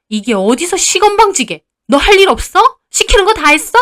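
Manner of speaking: loud, rising, high-pitched telling-off; scolding mother-in-law. A narrator's reading. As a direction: cut down a cheeky daughter-in-law. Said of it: "저를 막 나무라면서". (시건방지다고 호통치는 시어머니)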